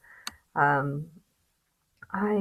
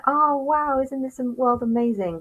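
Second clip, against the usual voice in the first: high, sarcastic gushing; mock cheerfulness; 7 semitones higher, slower than usual. Vlogger mocking fans of a happy band, high sarcastic gush. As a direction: gush like a fake-happy fan. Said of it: "you'll get, uh".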